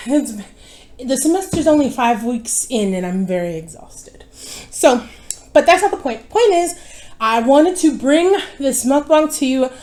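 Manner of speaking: ordinary delivery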